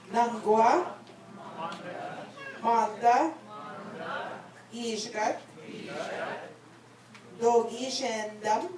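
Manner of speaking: phrase by phrase, long pauses for repetition; said slowly. Shown as a demonstration. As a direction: lead a group recitation, lifting each phrase's end. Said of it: "I'm gonna say it slowly".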